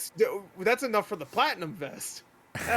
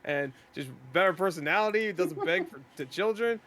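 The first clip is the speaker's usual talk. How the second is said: ordinary delivery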